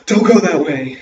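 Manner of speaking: loud, sharp warning cry; frightened shout. A narrator's reading. Sam warning his sister off the left path, loud and frightened. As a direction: stop her, scared stiff. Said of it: "Sam stopped and said".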